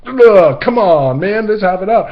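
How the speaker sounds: loud, high exasperated goading; egging him on with a groan; mock-frustrated urging, loud. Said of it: "I wasn't gonna".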